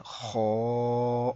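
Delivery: خو heavy and rounded, vowel held level; thick, full voice. Shown as a demonstration. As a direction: sustain خو with a full mouth. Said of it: "موٹی آواز میں پڑھا ہے". (خو پُر آواز میں)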